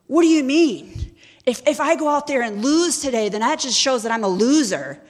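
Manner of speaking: loud, high-pitched outraged objection; indignant protest. Retold or imitated. Knight told by his love to lose, indignant protest. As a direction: protest in disbelief, voice pushed up.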